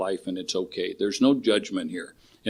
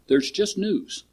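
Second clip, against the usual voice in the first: high, soft serene sage; calm master line; 8 semitones higher, 4 dB quieter, slower than usual. Retold or imitated. Retelling the turtle master calming the chicken, serene. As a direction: voice the master lightly, higher. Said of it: "Son, there's no terrible news".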